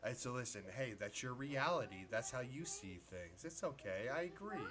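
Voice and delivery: soft, low imitation of a placating friend; mock calm. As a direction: imitate someone being sarcastically soothing. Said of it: "you got that calm".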